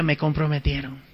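soft, low muttered dismay; muttered to self. Retold or imitated. Priest muttering to himself, softly, that he's trapped. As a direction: mumble it under your breath. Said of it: "Bueno, dije".

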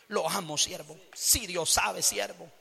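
very low, hushed fake devotion; imitating feigned affection, deep and soft; mock sweet flattery. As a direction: murmur it softly as a two-faced admirer. Imitates hypocrite flattering Cristian to his face.

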